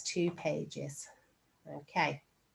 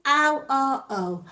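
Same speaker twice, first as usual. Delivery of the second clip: ow and O called out louder and slower; shout it out; 4 semitones higher, 6 dB louder, slower than usual. Shown as a demonstration. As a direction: shout ow or O for the class. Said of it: "Shout it at me".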